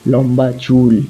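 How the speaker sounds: ordinary delivery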